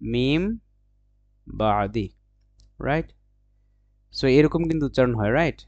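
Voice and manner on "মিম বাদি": "মিম ... বাদি" slowly in two held parts; slow, measured "মিম বাদি"; Arabic assimilation demo. Shown as a demonstration. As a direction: hold the m of "মিম", then a falling "বাদি". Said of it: "মূলত এটা উচ্চারণ হবে".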